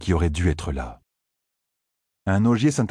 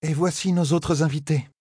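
high clear announcement; 10 semitones higher than usual. A narrator's reading. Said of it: "annonça d'une voix haute et claire". (annonce haute et claire)

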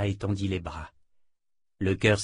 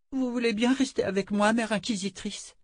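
put-on pleading little girl; 13 semitones higher than usual. Oli begs her to stay.

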